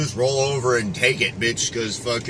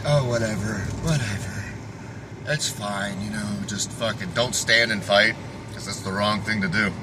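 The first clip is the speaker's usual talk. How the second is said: soft, limp, shrugging mock voice, voice dropping away; mocking dismissive voice; 5 semitones lower, 6 dB quieter than usual. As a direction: parody someone who just gives up, lightly. Mocking passive people's attitude.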